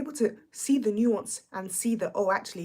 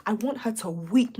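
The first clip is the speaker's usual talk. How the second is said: ordinary talk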